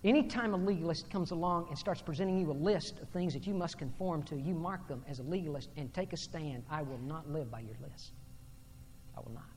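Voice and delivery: quiet warning that drops almost to nothing; hushed preaching. Real, not acted. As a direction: state it quietly and firmly.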